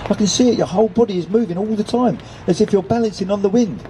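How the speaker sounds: sustained high-pitched wonder; amazed cry. A narrator's reading. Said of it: "cried Nelson in, in amazement".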